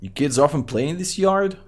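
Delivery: normal talking voice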